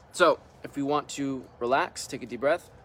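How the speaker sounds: calm meditation leader leaving room to breathe; guided meditation. Real, not acted. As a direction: guide them into relaxing, unhurried, one short instruction at a time. Leads a guided relaxation meditation.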